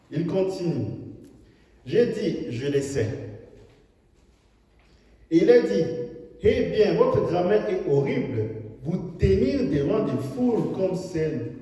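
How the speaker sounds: loud, high fervent sermon delivery; full-force preaching. Acted. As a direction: preach it out to the hall. Preacher retells critic scene.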